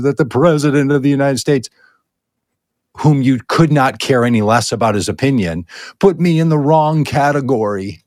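normal talking voice

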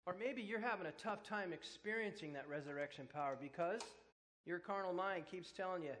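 normal talking voice

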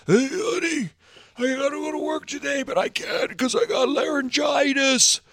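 mock a husband faking laryngitis to skip work; fake sick voice. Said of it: "saying to yourself".

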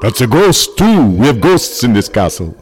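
a campy Count welcoming guests to his castle; Dracula accent. Acted. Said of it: "I'm not gonna do an accent".

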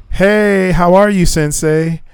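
a hint of a bubbly girl; mock girly text voice. Quoting her text message.

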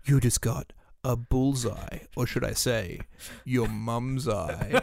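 slow, drawn-out villain delivery; villain's punning taunt. Acted. Sweeper taunting over the mum's eye, slow mock-villain voice. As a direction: draw out the pun like a gloating movie villain.

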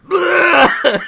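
very high, loud retching burst; hammed-up sudden heave; imitated vomiting. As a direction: mimic an explosive puke. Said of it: "vomiting in the tub".

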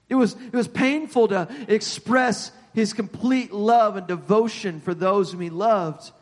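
emphatic pulpit delivery, punching key words; fervent preacher driving it home; earnest preaching. Real, not acted. Preaching with force.

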